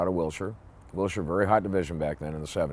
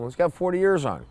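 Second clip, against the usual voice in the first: ordinary delivery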